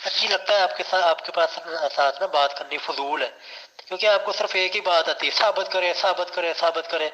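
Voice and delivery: loud, heated debate shout; angry shouting. Real, not acted. Debater shouting at his opponent in frustration, loud and angry. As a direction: shout him down, fed up and angry.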